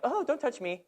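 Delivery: high, soft mocking whimper; mock timid voice. Preacher mocking a timid soccer player, high and soft. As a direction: squeak it like a scared kid.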